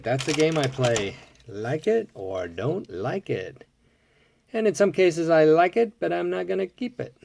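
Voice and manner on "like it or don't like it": slow musing repeat. Real, not acted. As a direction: let the motto settle, drawn out. Same rule repeated, musing.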